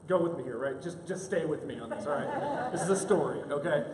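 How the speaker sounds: ordinary talk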